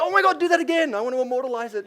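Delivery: high, loud gush of mock excitement. Dad begging his daughters to repeat something cute, mock gushing.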